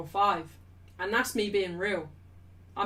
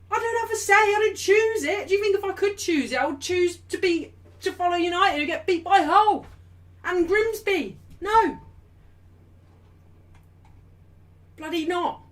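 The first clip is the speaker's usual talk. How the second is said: high-pitched, indignant rant; crashing out; 8 semitones higher, 5 dB louder than usual. Real, not acted. Streamer telling chat she never chose her club, crashing out. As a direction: protest loudly at the absurdity of it. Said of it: "big crash out there".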